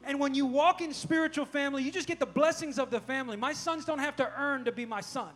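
loud, rallying sermon delivery; rally the congregation, driving each phrase home; full-force preaching. Acted. Preacher telling church they are sons and daughters, loud and rousing.

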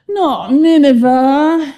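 slow, loud, high groan of protest. A narrator's reading. Jonah protests at being sent to Nineveh, groaning. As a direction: groan it out, dragging the words. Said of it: "he groaned".

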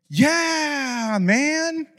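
high, soft, drawn-out fake assurance; unconvincing yes. Lying to his roommate about the call, high and evasive. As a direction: stretch it out high and soft, badly faking it.

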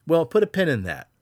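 usual speaking voice

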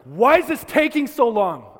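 high, loud, slow frantic yell; desperate parent yelling down the phone, slow and loud; retold scream. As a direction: shout it at the dispatcher in panic. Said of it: "I remember screaming at them".

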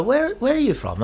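mock local asking where he's from, pitched well up; Sheffield local asking. Actor imitating a Sheffield local who questions his origins, pitched high. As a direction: lightly act a suspicious Sheffield native. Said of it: "if you were from Sheffield".

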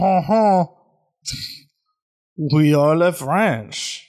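hammed-up French accent, drawn out; mock French. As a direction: put on a slow joke French accent. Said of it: "they're in a French town now".

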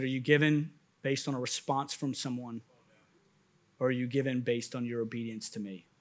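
low, hushed, measured question; quiet voice speaking to the heart; God speaking inwardly. Retold or imitated. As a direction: ask softly and gravely, voice dropped. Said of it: "God speaking to my heart".

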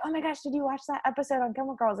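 a quick, high burst of mock excitement; excited friend. Voicing an excited friend about TV.

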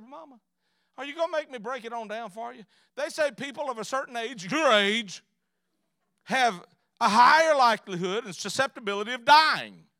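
loud, high, mounting exasperation; building to a loud, high finish; exasperated explaining. Acted. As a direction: break it down forcefully for a stubborn parent. Exasperated son spells out the risk.

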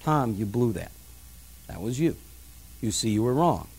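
low, soft, measured correction; quiet, rational. Retold or imitated. Wife privately correcting her husband after his outburst, quiet and rational. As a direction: calmly correct him under your breath. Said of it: "in a quiet, rational manner".

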